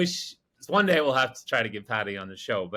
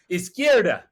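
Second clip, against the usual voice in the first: higher, louder mock shouted directions; imitated yelling out; 5 semitones higher, 5 dB louder than usual. Patty giving wrong directions to the driver, mock yelling out. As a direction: shout it while fumbling for the Spanish word. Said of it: "he starts yelling out".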